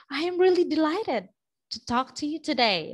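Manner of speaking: bright upbeat delighted delivery. Shown as a demonstration. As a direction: show real delight in the voice. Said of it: "show to them that you are".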